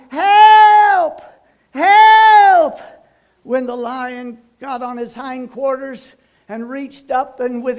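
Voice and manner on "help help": high, loud shout for help from the rope; desperate cry for help. Retold or imitated. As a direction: yell for help, way up high and full voice. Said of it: "he kept crying".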